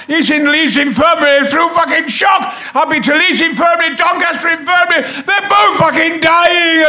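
shouted. Retold or imitated. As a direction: yell it out in mock panic over a family crisis. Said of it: "and I just shouted".

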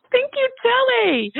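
high, drawn-out squeal of thanks; elated thanks. Retold or imitated. Acting out her elated gratitude.

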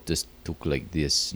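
low, soft, monotone mock Russian; monotone Russian accent. Shown as a demonstration. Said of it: "Russian accent is very monotone".

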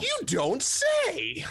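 high, slow mock amazement; drawn-out sarcastic gasp. Sarcastic reply at the table.

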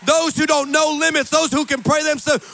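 loud, high, shouted sermon build; fiery preaching. Acted. Preacher rallying the congregation, fiery shouting. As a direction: preach it over the crowd at full force.

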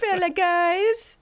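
high, slow put-on lady voice; alien lady voice. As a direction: play an alien faking a lady. Same alien voice.

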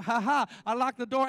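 loud mock-triumphant ha ha; a playful, hammed-up gloat; gloating taunt. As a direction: crow over locking her out. Gloating after locking wife out.

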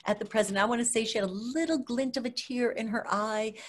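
ordinary talk

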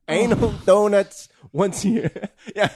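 repeating it through laughter; giggling out the words; laughing. Real, not acted. Repeats the joke while laughing.

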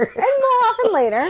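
high, mocking echo; mocking disbelief. Real, not acted. Mocking repeat.

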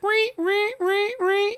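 very high shrieking ree ree like the shower-scene strings; piercing imitated violin stabs; Psycho screech. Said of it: "kinda like Psycho".

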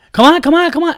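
high, loud impatient "come on", imitated; impatient yelling. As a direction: hurry them up like the pushy dad. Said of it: "the guy's like".

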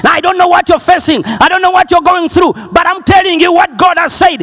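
rising sermon fervour; full-force preaching. Real, not acted. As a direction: preach it out to the crowd.

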